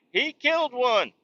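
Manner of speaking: celebratory mock chant; mock crowd cheering. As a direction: imitate jubilant neighbors in a high voice. Neighbors cheering him in story.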